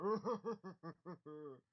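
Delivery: mock ogre guffaw; laughing ogre sitting downstairs. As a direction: laugh it off like a giant brute. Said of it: "he's like".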